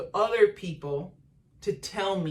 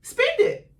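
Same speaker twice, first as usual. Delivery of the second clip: higher, louder, fast grandma voice; grandma urging granddaughter to spend, higher and louder; imitating grandma; 4 semitones higher, 6 dB louder than usual. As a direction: sweetly scold like a grandma. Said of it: "She's like".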